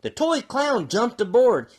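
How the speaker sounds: plain reading voice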